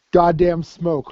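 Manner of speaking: ordinary talk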